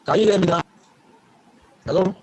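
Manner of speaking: ordinary delivery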